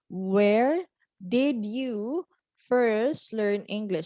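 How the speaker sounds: WHERE, DID YOU and FIRST drawn out slowly and punched; very slow, each of WHERE DID YOU FIRST stressed; stressed key words. Shown as a demonstration. Teacher giving an example exam question, slow heavy stress on DID. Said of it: "you have to stress the word".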